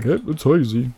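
mock the crowd's excited cheer; mock trend follower. Imitating everybody jumping on the hazy trend, mocking.

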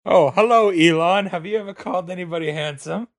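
put-on society voice, rising to a question; hoity-toity rich voice. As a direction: play a rich snob making small talk. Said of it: "rich hoity-toity way of, like, speaking".

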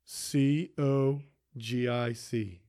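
slowly spelled, C O G I C, letter by letter; spelling it out. Shown as a demonstration. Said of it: "Can you spell that word for me?".

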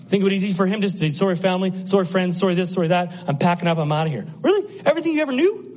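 high, rapid-fire acted exchange; animated preaching. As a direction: play both sides, the questions pitched very high and incredulous. Preacher acts Abraham leaving, questioned.

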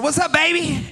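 high, showy smooth-talker greeting; smooth pickup line. Retold or imitated. Preacher jokingly flirting with his future wife, hammed-up smooth. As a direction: play the slick flirt. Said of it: "I said".

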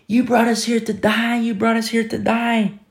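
high-pitched mock wailing of the people; complaining crowd. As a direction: whine it high, like an accusing mob. Preacher voices the panicking people.

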